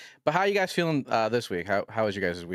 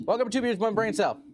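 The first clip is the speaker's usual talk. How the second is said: much higher, louder show welcome; announcer voice; 11 semitones higher, 3 dB louder than usual. Acted. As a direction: belt out the show's welcome. Repeats podcast intro as announcer.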